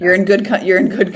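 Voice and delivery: laughing through the words. Real, not acted. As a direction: break into a laugh mid-word. Laughing reply to shared praise.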